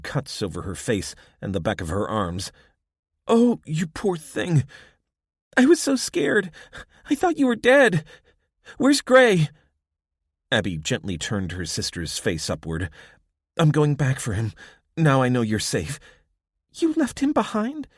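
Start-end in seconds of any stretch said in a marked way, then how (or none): 3.3-9.5 shaken and scared. A narrator's reading. Abby overwhelmed seeing sister. 13.6-16.0 higher, louder resolve; determined girl. A narrator's reading. Ray tells sister her plan. 16.7-17.9 very high, accusing question; shocked. A narrator's reading. Ray shocked.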